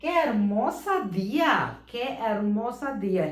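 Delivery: slow, careful Spanish; slow demo. Shown as a demonstration. Teacher modelling the phrase for students, slowly. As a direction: say each word slowly for learners. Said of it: "അതുപോലെ".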